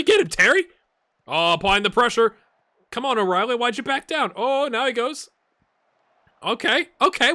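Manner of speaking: high, loud match-day yelling; loud, high-pitched excited urging; excited shouting. Real, not acted. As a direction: shout at the screen as the press bites. Shouting at pressing play.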